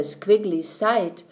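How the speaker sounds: ordinary delivery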